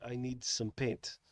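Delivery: put-on slow, quiet Russian accent; understated Russian-accented customer; imitated Russian accent. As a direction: say it slowly in a low-key Russian accent. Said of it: "he had a Russian accent".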